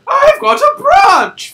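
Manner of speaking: very high, slow, cartoonish; high squeaky voice. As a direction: squeal it out slowly. Voicing a reply.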